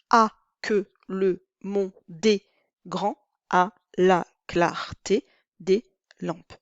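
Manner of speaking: slow, syllable by syllable verse recitation; slow scansion reading. Shown as a demonstration. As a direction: count out every syllable slowly. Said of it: "Douze syllabes".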